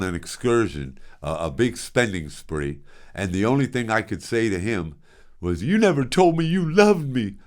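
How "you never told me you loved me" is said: higher, hammed-up wounded plea; theatrical plea. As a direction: play it up like a hurt son in a melodrama. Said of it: "I said it strong too, like, uh, theatrically".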